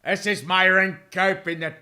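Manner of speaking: attempted sportscaster impression; Myron Cope. Shown as a demonstration. Fan attempting a sportscaster's voice, tentative impression. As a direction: try out a famous announcer's voice. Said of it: "I can kinda do a Myron Cope".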